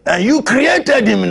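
climbing, crying preacher's wail on standing; rising preaching cry. Acted. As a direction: build the repetition to a wailing shout.

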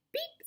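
short, high-pitched little horn beep; high truck beep. A narrator's reading. Blue greets a big green toad, high beep. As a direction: beep like a tiny friendly horn. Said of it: "said Blue to a big green toad".

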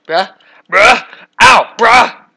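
high, loud yelling bruh; pained shout. Real, not acted. Gamer hit by mobs, pained shout. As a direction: cry out as you get hit.

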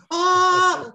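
very high, loud "ah" scream; imitated scream. Retelling a rude awakening at five thirty, high mock scream. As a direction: shriek as if jolted out of sleep. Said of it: "and screaming".

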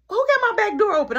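loud, high mock mom demand; imitating outraged mom. Mom on the phone hears back door open, loud mock outrage.

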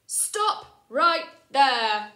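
slow, loud commanding halt; bossy stop. Acted. George halting Freddy and the twins, slow and loud. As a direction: stretch out the order.

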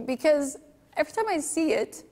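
choked up, voice cracking high; moved by the client's sacrifice, on the edge of tears; voice breaking. Real, not acted. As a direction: fight back tears as you speak. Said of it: "I'm sorry I'm taking you guys down".

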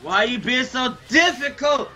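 high-pitched real exasperation; exasperated shout. Real, not acted. Pleading with a friend who threatens to block, exasperated shout. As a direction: raise the pitch and yell in frustration. Exasperated quarrel over the run.

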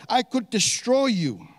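"destroy you" pitched high and hit firmly; emphatic reading. Acted. Laban threatening Jacob, emphatic reading. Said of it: "gonna emphasize some of the words".

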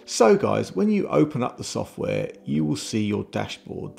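ordinary talk

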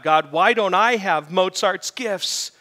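acted anguished appeal; crying out at God. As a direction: cry out in dramatic complaint to heaven. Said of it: "he's crying out against God".

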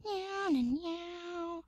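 high, soft cat-like party tune; thin high meows, falling away; high singsong meowing. Pretend. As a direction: mew a little tune up high.

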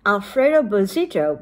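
ordinary delivery, nothing marked

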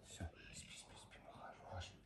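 hushed whisper lulling to sleep. Real, not acted. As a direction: whisper softly as if lulling a pet.

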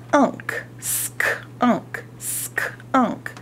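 "S" and "k" hissed unvoiced; sounded out in whisper. Shown as a demonstration. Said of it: "whispered consonant sounds".

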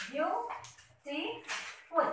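very slow, each syllable pulled apart; teacher counting syllables in "Beautiful" slowly. Shown as a demonstration. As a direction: stretch "Beautiful" out slowly so every syllable is heard. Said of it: "You have three syllables".

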